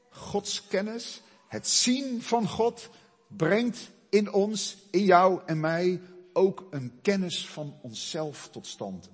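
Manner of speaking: high, loud and slow, each phrase hammered; preaching at full force. Real, not acted. Preacher drives home knowing God to his church. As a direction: build it up like a pulpit climax. Preaching climax on self-knowledge.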